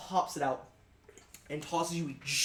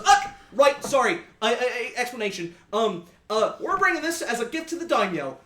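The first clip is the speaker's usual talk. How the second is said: put-on higher, louder hurried sailor accent; flustered sea captain; 4 semitones higher, 7 dB louder than usual. Captain explaining the gift to the party, high loud sailor accent. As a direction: catch yourself and explain in a rush. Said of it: "stops and goes".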